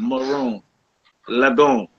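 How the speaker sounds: maroon as muh-ROON, lagoon as luh-GOON; short slurred vowels. Shown as a demonstration. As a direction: demonstrate maroon and lagoon with 'uh' first vowels. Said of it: "ma sound".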